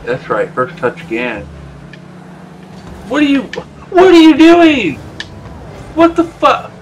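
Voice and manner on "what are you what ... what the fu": loud, much higher, incredulous shout; outraged high-pitched yell; exasperated yell. Real, not acted. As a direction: scream it at a teammate who blew it. Yelling at a teammate's mistake.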